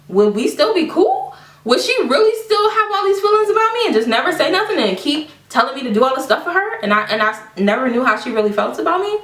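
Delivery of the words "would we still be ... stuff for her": high, rapid run of wounded questions; disbelieving questioning. Retold or imitated. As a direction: wonder aloud in disbelief, pitch climbing. Retelling doubts voiced to her friends.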